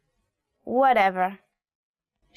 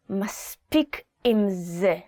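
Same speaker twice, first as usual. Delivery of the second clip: through gritted teeth; 2 semitones lower than usual. Shown as a demonstration. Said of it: "say it through your teeth".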